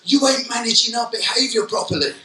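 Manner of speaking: put-on sulky teen complaint, said up high; mock pupil complaint. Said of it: "their complaint is".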